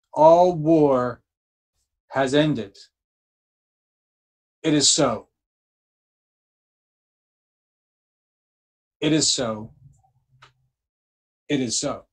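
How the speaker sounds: preacher sealing a vow with feeling; emotional declaration. Shown as a demonstration. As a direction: proclaim it with conviction, then let it rest. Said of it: "You can feel my emotion when I say it that way".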